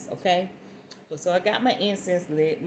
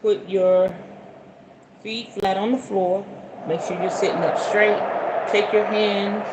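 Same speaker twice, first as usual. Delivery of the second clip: guided meditation. Acted. Guiding viewers into a calm sitting posture. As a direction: settle the listeners into their seats.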